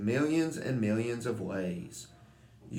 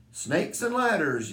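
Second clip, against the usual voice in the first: yelled; 7 semitones higher, 4 dB louder than usual. A narrator's reading.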